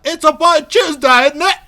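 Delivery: mock posh British. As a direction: ham up a plummy British accent. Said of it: "British people are like".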